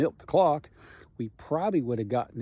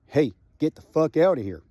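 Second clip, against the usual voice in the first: a gruff order, somewhat louder; retold order; 3 semitones higher, 5 dB louder than usual. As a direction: order him off the scene. Firefighter ordering him away.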